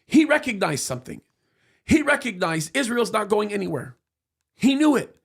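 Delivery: usual speaking voice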